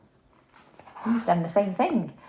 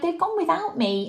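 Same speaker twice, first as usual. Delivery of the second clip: cried; 10 semitones higher than usual. A narrator's reading. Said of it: "And he cried".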